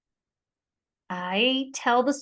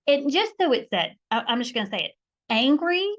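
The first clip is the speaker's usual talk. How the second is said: ordinary talk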